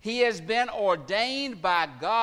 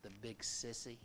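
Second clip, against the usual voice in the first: trembling little voice; 9 semitones lower, 19 dB quieter than usual. Retold or imitated. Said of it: "this little trembling voice said".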